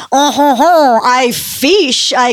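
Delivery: put-on hammy French accent, slow; overdone French gentleman voice. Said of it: "Andre Poisson is like".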